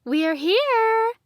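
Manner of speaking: high, loud bright announcement; announcing. A narrator's reading. Talia announces arrival to blindfolded friend, loud. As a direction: call out the arrival. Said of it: "Talia announced".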